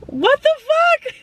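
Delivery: sobbing, voice high and breaking; laughing and sobbing at once; crying. Real, not acted. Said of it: "this is not gonna be a good video".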